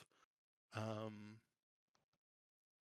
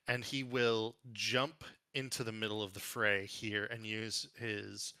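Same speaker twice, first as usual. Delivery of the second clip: ordinary talk, nothing marked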